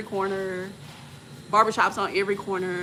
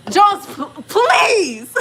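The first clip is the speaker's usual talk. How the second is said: loud, high shouted plea; exasperated plea; 11 semitones higher, 7 dB louder, much slower than usual. Acted. Traveler begging companion, exasperated shout. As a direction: shout it, dragging out the please.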